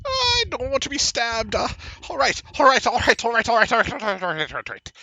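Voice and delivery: whimpered plea tumbling into rapid surrender; cowering halfling. Acted. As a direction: start high, babble and fade in defeat. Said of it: "he is cowering".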